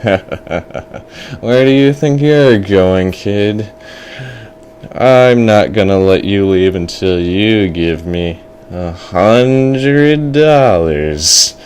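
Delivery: slow, stretched villain voice; menacing fortune teller. Acted. Fortune teller demands money from trapped boy, slow menace. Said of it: "looked back to the fortune teller".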